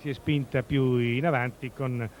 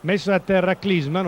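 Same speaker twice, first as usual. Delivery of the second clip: louder, higher call of the foul; excited commentary; 4 semitones higher, 6 dB louder than usual. Real, not acted. Foul called live.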